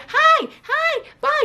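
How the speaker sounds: high-pitched mock child greeting; imitating excited kids. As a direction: mimic kids piping up for the camera. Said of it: "Khaleesi and Damien always be".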